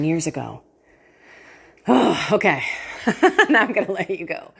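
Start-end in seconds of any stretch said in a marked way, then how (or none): 2.7-3.4 breathy giggle up high; a quick high laugh at herself; high airy laugh. Real, not acted. Host laughing at her own rambling, airy giggle.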